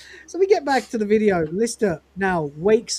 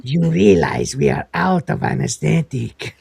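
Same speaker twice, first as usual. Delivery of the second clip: lower, menacing doctor voice; malicious doctor; 9 semitones lower than usual. Retold or imitated. Masked doctor warns Lister, low and malicious. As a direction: lean into a sinister low threat. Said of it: "his voice is much more malicious".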